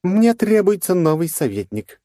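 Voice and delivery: high, loud cold queen voice; cool and unbothered; calm queen. Acted. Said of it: "прозвучал спокойный голос королевы".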